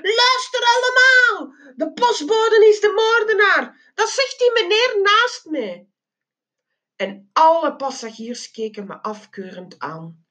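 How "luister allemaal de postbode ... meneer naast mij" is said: high-pitched shriek to everyone; shrill, excited public announcement; loud screaming. A narrator's reading. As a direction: yell it out to the whole cabin. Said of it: "begon luid te gillen".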